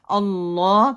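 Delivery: اللہ with a thick, rounded "Alloh"; firm thick l in اللہ; heavy lam. Shown as a demonstration. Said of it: "دونوں کا اللہ موٹا پڑھا جائے گا".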